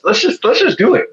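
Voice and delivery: a high, eager push to just go for it; talking his wife into the move, eager and high; excited urging. Retold or imitated.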